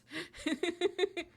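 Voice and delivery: high, loud giggle; laughing. Real, not acted. Streamer amused by her failed accent, high giggling. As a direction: burst into high giggles at yourself.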